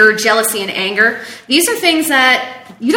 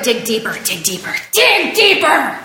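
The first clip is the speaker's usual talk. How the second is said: building urge. Acted. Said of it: "therapist coaching a build".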